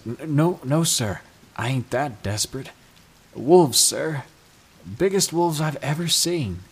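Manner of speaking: terrified hunter blurting it out; frightened stammer. A narrator's reading. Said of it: "I see he's shaking".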